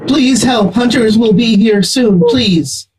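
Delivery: high, pleading alien insect; insect creature voice. Acted. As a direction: beg for help as a small insect creature. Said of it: "Get an insect voice".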